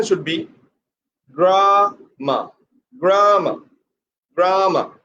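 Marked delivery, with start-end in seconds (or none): 1.3-4.9 GRAMA with a long GRAA, falling on MA; stress on first syllable. Shown as a demonstration. Teacher showing the pronunciation should be so, stressed GRAA-ma. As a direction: stretch and press the start of GRAMA. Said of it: "Put pressure on the first syllable".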